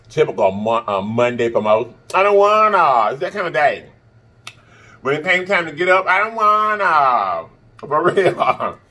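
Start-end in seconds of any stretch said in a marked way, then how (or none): 2.1-3.1 whiny. Pretend. Whining about Monday. 6.1-7.5 high, slow, drawn-out whine; whiny. Pretend. Whining about getting up.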